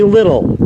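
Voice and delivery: "little" as the store's name, short i; "little" rhyming with "brittle"; short-i variant. Shown as a demonstration. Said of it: "Some people say".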